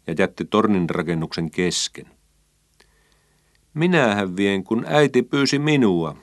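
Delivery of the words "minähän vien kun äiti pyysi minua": brisk and sure of herself, higher; a feisty girl claiming her right, pitched up; spunky. A narrator's reading. Emmi insisting to Taneli she was asked, spunky and pitched up. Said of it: "Emmi vastasi topakkana".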